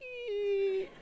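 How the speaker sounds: high, thin squeak of a slowly turning handle; door creak. Retold or imitated.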